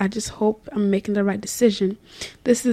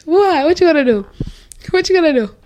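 teasing challenge; 7 semitones higher, 6 dB louder, faster than usual. Real, not acted. Teasing challenge while laughing.

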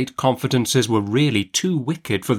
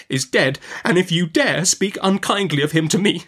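higher-pitched, wounded protest; indignant mother; 8 semitones higher than usual. A narrator's reading. Mother warns aunt against slighting dead husband, indignant. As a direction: stand up for him with feeling.

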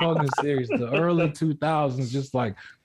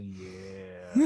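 slowed acid voice; 11 semitones lower, 6 dB quieter than usual. Pretend. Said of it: "the acid kicks in and I get back to".

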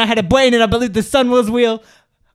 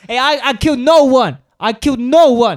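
louder, emphatic protest of innocence; mock-indignant raised voice; loud denial; 3 semitones higher, 5 dB louder than usual. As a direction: insist loudly, hitting "no one". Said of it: "This is really loud".

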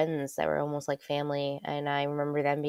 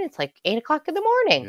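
retold baffled outburst; 12 semitones higher, 6 dB louder than usual. Retold confused reply to mom.

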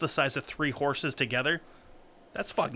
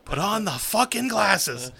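movie quote shout; 6 semitones higher, 4 dB louder, slower than usual. Retold or imitated. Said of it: "Roddy Piper movie".

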